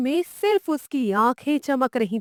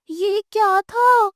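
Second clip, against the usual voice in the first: put-on high, louder, slow startled child; startled question; 7 semitones higher, 3 dB louder than usual. Boy hears a bang outside, high and startled. Loud noise outside at night.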